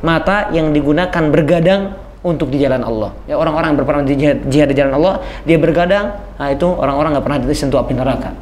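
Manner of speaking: ordinary delivery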